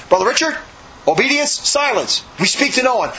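high, measured mock reprimand; imitated stern command. Brother Michael behind Richard ordering obedience, imitated stern command.

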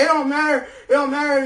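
put-on loud, high drunken rant. Drunk neighbor confronting the narrator, loud and high. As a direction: rail at him like an angry drunk. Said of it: "He gets right in my face".

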